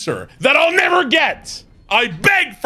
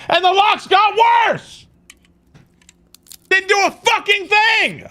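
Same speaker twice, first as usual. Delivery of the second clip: angry shout; 9 semitones higher than usual. Real, not acted. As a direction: yell it out in real frustration. Raging over failed lockpicking.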